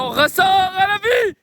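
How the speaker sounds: high, slow, loud proclamation; triumphant shout. Pretend. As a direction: burst out like reborn. Dramatic emergence from tent.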